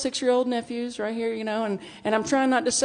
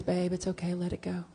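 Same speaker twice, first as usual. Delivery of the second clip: softer, soothing reassurance; calming wife; 2 semitones lower, 7 dB quieter than usual. Retold or imitated. Wife texting her to let it go, soft and soothing. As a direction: gently talk her down. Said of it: "She goes".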